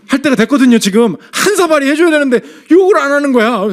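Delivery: loud, high storytelling burst; worked-up, high-pitched incredulity; animated exclamation. Real, not acted. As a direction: play up the astonishment for the congregation. Comic build-up of missing scolding.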